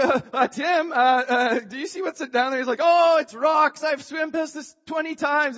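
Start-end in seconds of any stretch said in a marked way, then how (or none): 0.1-2.6 hesitant, raised voice calling to a friend; mildly alarmed retold query; nervous question. Nervously calls swimmer ahead in retold scene. 2.8-5.6 loud, higher-pitched brush-off; acted-out breezy reassurance; dismissive call back. Friend brushes off worry, calling back.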